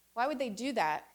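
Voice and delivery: ordinary talk, nothing marked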